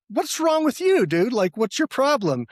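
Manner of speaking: high, loud, incredulous calling-out; exasperated yell. Retold or imitated. Runner yelling at his screaming friend in the pool, exasperated. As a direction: demand an answer, voice shooting way up.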